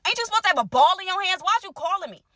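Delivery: high, fast, sassy disbelief; retold sass at the boyfriend on the phone; mock incredulous. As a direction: fire it back at him, quick and high. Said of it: "I'm like".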